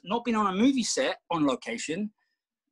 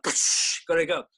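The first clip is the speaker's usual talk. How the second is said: slow, drawn-out, louder put-on order; mock stern wife; 5 dB louder, much slower than usual. Husband mimicking his wife's slow firm order. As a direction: stretch it out like a firm command. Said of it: "She doesn't talk like that".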